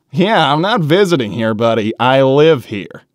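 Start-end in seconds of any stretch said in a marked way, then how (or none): none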